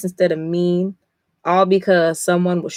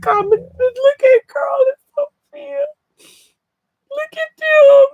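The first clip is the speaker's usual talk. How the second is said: much higher, crying out loud; sobbing; 6 dB louder than usual. Real, not acted. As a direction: wail it through tears. Crying again over final panel.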